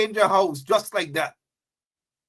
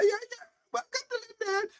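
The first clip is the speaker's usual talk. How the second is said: mock voice; 10 dB quieter than usual. Mocking the linen-wearing crowd.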